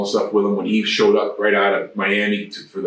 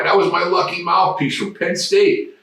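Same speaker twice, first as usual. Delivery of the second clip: near tears; 9 semitones higher than usual. Retold or imitated. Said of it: "Matt looked like he was gonna cry".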